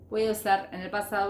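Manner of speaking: ordinary delivery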